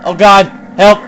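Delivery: loud, high panicked yelling; frightened shouts at being left alone. Real, not acted. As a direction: yell for help in terror. Left alone in horror game.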